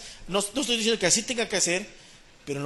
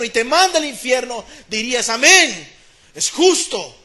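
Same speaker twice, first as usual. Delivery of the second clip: loud, raised sermon building to a peak; full-force preaching; 3 semitones higher, 7 dB louder than usual. Acted. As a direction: drive each phrase at the congregation, stretching the climax. (predicación enérgica a plena voz)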